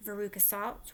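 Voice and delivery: ordinary talk, nothing marked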